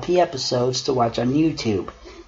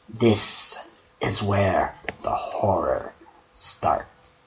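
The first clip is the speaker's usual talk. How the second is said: spooky whisper; 3 semitones lower, 6 dB quieter, slower than usual. Acted. Narrator spooking the listener, eerie whisper. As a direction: drop to a hushed, eerie whisper. Spooky creepypasta reading line.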